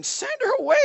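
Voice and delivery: high-pitched, exasperated begging. A narrator's reading. Disciples begging Jesus to dismiss the woman, high and pleading. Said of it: "begged him, saying".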